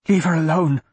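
tense, urgent plea; begging. A narrator's reading. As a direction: plead for the child's safety. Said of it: "Wyatt begged".

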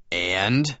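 ordinary delivery

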